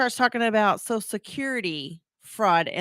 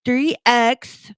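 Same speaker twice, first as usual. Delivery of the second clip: firm, louder dictation of each item; caller dictating; 4 semitones higher, 6 dB louder than usual. Retold or imitated. Scam caller dictating a code to take down, insistent. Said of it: "So he would say".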